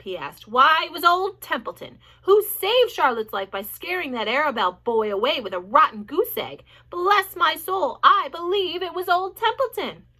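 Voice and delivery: loud, self-important rat grumble; boastful sulky rat. A narrator's reading. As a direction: brag with wounded pride. Rat bragging self-pity.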